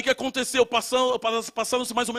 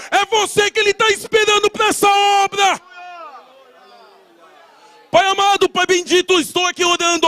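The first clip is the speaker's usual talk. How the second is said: fervent shouted prayer; 9 semitones higher, 8 dB louder than usual. Acted. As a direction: pray aloud over the congregation with full fervor. Pastor leads congregation in prayer.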